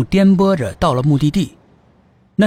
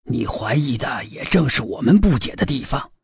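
put-on old police chief; 2 semitones lower than usual. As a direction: answer like a seasoned old cop.

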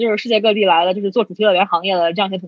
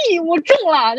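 high, excited mock cry of triumph; mock shout of joy; 6 semitones higher than usual. Shouting to friends in the group chat after landing the job, high and excited. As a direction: yelp it like a giddy winner. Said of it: "就在群里面大喊".

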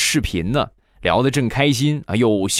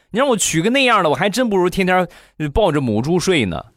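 exasperated rant; 9 semitones higher than usual. Retold or imitated. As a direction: rant at mom in a high pitch. Cousin ranting about blind date.

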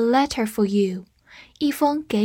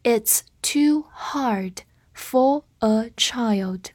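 very slow, spaced-out words; slow read-through; much slower than usual. Shown as a demonstration. Teacher modelling "for a child" for learners, slow read-through. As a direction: read slowly, pausing after every word. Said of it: "我们慢读一遍".

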